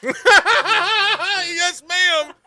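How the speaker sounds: high, loud, laughing through the words; gleeful laughing yes; real delighted laughter, slowed down. Real, not acted. Laughing agreement with the clip.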